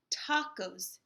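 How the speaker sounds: plain reading voice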